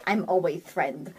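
low, soft cat voice; friendly cat character. Acted. Voicing Scribbles befriending a child.

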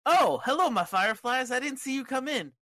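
put-on high, loud kids'-show host greeting; Bray Wyatt. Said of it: "and he says".

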